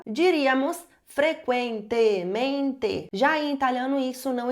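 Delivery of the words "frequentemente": frequentemente said slowly, every syllable clear; teacher spelling out frequentemente; spelled out. Shown as a demonstration. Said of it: "se formos soletrar".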